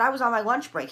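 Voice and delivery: acting out an outraged excuse; indignant protest. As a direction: push back loudly against the accusation. Acted protest to the officer.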